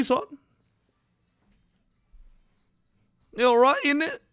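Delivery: high, loud hammed-up British accent; a mock Brit giving himself a pep talk. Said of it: "I don't know how to do a British accent".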